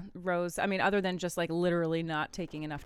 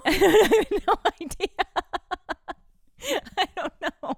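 laughing; 11 semitones higher, 9 dB louder than usual. Real, not acted. Laughing at own story.

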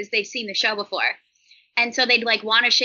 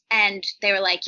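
normal talking voice

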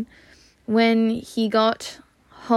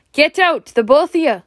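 squawked order; 6 semitones higher, 5 dB louder than usual. Acted. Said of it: "squawked Uncle Vernon".

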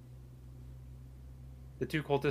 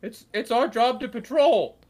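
much higher, louder squeaky cultist Jerry; 10 semitones higher, 10 dB louder than usual. Acted. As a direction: pitch Jerry way up, loud and nasal-silly. DM voicing Jerry in dialogue.

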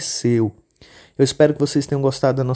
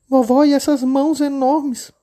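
put-on much higher, childlike little girl voice; 12 semitones higher, 4 dB louder than usual. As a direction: play the innocent little girl. Menina pergunta. (menininha de voz fininha e aguda)

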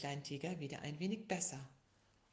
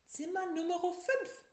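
high, brisk nurse announcement; nurse duck voice; 13 semitones higher, 5 dB louder than usual. A narrator's reading. Nurse duck telling the patient his room, high brisk. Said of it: "sagte Schwester Luzi".

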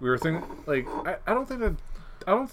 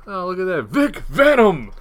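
mock excitement; 7 semitones higher, 10 dB louder than usual. Imitating co-host suddenly spotting Vic Venom, hammed-up excitement. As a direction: holler the name as if suddenly sidetracked. Acting out co-host's distracted outburst.

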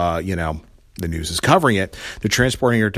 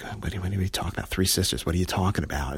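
hushed; 3 semitones lower, 10 dB quieter, faster than usual. Retold or imitated. Kate baffled by Alice's talk.

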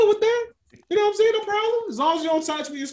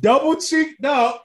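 laughing shout; 7 semitones higher, 3 dB louder, slower than usual. Retold or imitated. Acted catcaller shout.

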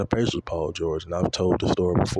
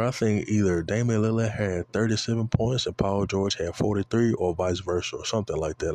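ordinary talk

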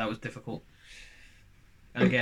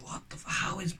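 whispering; 6 semitones higher, 8 dB quieter than usual. Retold or imitated. Whispered disbelieving thought.

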